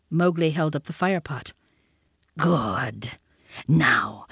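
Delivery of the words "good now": unvoiced low whisper. A narrator's reading. Panther approving Mowgli's fire pot, whispered. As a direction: hush it like a panther.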